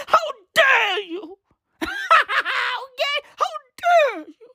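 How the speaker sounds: high, cracking mock cry of outrage. Mocking climate activists with a high wailing outcry. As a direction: wail how dare you like a tearful activist.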